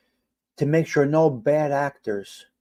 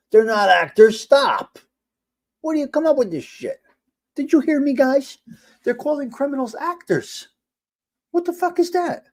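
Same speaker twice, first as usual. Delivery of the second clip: high-pitched, indignant rant; exasperated outburst; 11 semitones higher, 4 dB louder than usual. Real, not acted. Streamer ranting to viewers about criminals called actors, exasperated. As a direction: protest in high-pitched disbelief.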